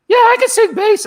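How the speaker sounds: very high, loud squeak; a tiny cartoon-mouse yelp; squeaky nervous voice. Telling Kenny he can sing bass, squeaking. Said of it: "it's like Mickey Mouse".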